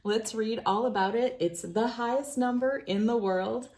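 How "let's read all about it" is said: usual speaking voice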